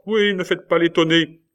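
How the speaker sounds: high, loud sneering voice; accusing magistrate. A narrator's reading. Magistrate to Cornelius feigning innocence, loud accusation. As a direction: cut through his feigned surprise.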